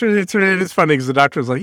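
usual speaking voice

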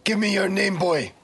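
threatening soldier. Acted. Soldier giving stranger a count to ten, threatening. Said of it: "So the soldier".

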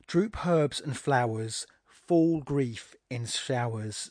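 slow verse, DROOP and FALL weighted first; stressed line openings. Shown as a demonstration. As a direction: lean on "droop" and "fall", slowly. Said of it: "both beginning with a stressed syllable".

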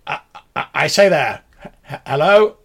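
stammering, high loud call to a stranger; tentative gentleman hailing someone, voice rising; awkward, halting hello; hesitant gentleman greeting. Acted. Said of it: "he walks up and he's like".

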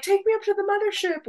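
high, quick mock plea; mock eager abductee. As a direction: squeal it like a thrilled fan begging to go.

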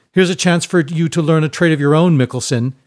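loud, high, lordly taunt; mock him loudly from above; jeering bully. Esquire taunts Seinen over spilled books, jeering.

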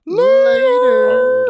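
loud high yell of later; shouted goodbye. Real, not acted. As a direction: belt out a farewell. Sign-off yell.

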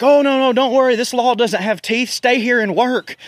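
high mock sweet-talk; lightly hammed-up fake reassurance; mock reassuring. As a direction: coax them like a sly salesman.

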